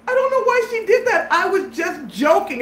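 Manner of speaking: high, pleading self-defence; imitated scared excuse. Scared friend explains himself to the storyteller, anxious excuse. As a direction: plead innocence, pitch coming down at the end. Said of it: "'cause now he's scared".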